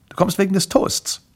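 high, light-leveled character voice; woman's voice. Acted. Woman asks Grimpel about the toast, high voice. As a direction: voice a woman at her door, pitched high.

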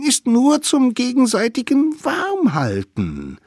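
high innocent little voice; sweet child promise. A narrator's reading. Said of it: "versprachen die kleinen Wilden".

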